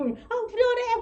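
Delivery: high, loud "아, 후련해" of sudden relief; relieved exclaim. Retold or imitated. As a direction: blurt out relief in a gossip session. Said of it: "그러면서 막 이러고". (속 시원하다는 들뜬 외침)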